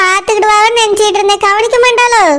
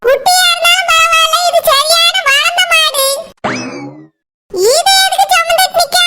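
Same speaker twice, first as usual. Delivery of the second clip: much higher put-on old woman's voice, scolding; shrill grandmother putting her daughter-in-law in her place; old mother-in-law; 8 semitones higher than usual. Mother-in-law scolding Saroja about a bride for Surya, high scolding old woman voice.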